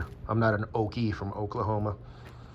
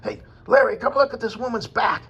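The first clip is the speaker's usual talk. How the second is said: crank it way up high like a stunned yokel yelling to Larry; mimicking a comedian's shocked onlooker bit; excited comic impression; 3 dB louder than usual. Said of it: "he was talking like".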